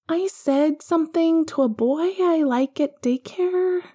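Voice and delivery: put-on high, bright child asking a favour; young boy asking to watch TV; little boy voice. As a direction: pitch up into a small boy. Young Harry confesses a problem.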